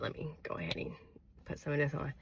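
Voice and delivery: unvoiced quick aside whisper. Real, not acted. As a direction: whisper it to yourself. Aside while applying lip balm.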